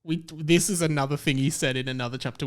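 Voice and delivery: usual speaking voice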